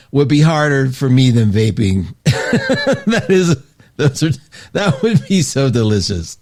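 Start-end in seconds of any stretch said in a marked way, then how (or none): 2.2-3.0 high laugh. Real, not acted. Laughs at Kit Kat comment. 3.1-6.4 laughing. Real, not acted. Laughing about the snack.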